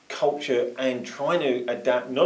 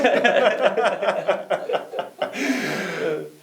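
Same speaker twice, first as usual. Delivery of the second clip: big falling laugh, pitched up; a loud real laugh; hearty laugh; 7 semitones higher, 3 dB louder than usual. Real, not acted. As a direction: burst out laughing at the joke. Host laughs at strict boss joke.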